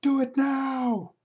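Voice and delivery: high, loud urgent shout, slowing on the second line; urgent yell. Acted. Comic character yelling at someone to jump now. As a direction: yell it like someone on a ledge is in danger.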